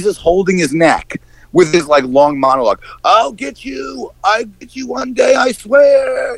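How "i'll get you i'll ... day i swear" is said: high, slow, hammed-up yell; hammy villain threat. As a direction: shout it like a cartoon villain. Mocking villain's monologue.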